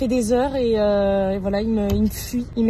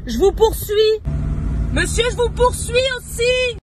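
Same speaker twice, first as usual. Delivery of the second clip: mock menace; 9 semitones higher, 4 dB louder than usual. Mock threat chasing passer-by.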